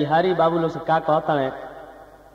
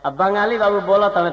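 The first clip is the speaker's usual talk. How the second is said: usual speaking voice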